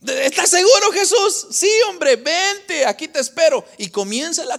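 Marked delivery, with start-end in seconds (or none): 0.0-1.5 high, raised-voice question; acted questioning. Peter checks with Jesus before stepping out, high-pitched. 1.5-3.6 high, lively come-on; acted urging. Jesus calls Peter onto the water, high and urging.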